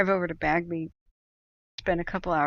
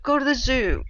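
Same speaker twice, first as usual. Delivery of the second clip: exasperated retort; 7 semitones higher, 5 dB louder than usual. Retold or imitated.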